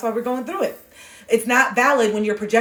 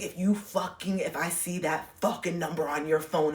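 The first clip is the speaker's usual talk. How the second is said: snarling threat; 3 semitones lower, 7 dB quieter than usual. Retold or imitated. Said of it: "look how I'm literally snarling".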